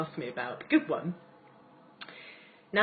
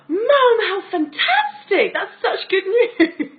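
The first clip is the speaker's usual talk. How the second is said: much higher and louder, slow, laughing through tears; joyful exclamation; 13 semitones higher, 11 dB louder, slower than usual. Retold or imitated. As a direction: burst out with tearful delight at the news. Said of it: "I was smiling with joy".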